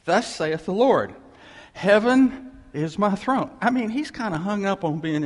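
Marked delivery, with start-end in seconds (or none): 0.0-3.5 scripture reading. Real, not acted. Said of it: "I'll read the first verse".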